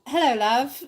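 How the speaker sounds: put-on a mild, amateur British accent; imitating a bad British accent. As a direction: greet the husband in a jokey British accent. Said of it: "I don't even do a good accent".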